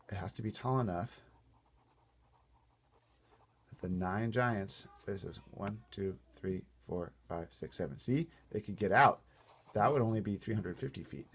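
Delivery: ordinary delivery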